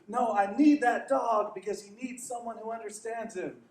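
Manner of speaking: put-on determined little boy; boy insisting. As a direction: plead for the puppy with conviction. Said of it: "and he says".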